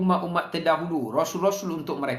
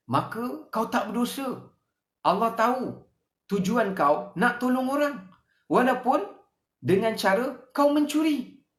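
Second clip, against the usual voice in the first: higher, lightly voiced tempting devil; Satan's persuasive voice; 5 semitones higher than usual. Satan persuading a thief that helping others excuses stealing, higher persuasive voice. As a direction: sweet-talk a thief into feeling righteous. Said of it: "Dia kata".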